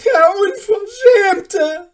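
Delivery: slow, very high, full-volume cry; outraged scream. A narrator's reading. Mother denouncing the shameless dwarf, outraged scream. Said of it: "rief Hanne ihrer Nachbarin zu".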